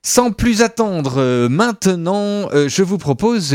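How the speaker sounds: lancer la rubrique avec entrain, lentement; annonce enthousiaste. Acted. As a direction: ton d'animateur qui annonce, traînant. Annonce du jingle, voix d'animateur. (annonce d'animateur enjouée)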